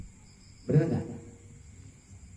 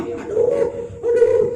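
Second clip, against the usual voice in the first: high-pitched wail of pain; acted child's crying; 3 dB louder, slower than usual. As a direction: mimic a hurt child crying out. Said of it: "nangis kan".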